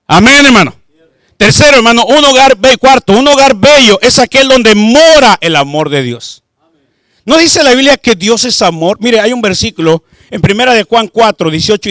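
loud, fervent sermon delivery; preaching at full force. Acted. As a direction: preach it out to the congregation.

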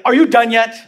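acted-out impatient fury; furious wife. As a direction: snap it like a fed-up wife, mock-furious. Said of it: "Now she's furious".

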